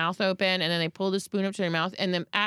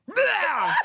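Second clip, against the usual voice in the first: vomit noise; 9 semitones higher than usual. Retold or imitated. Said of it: "And then he goes".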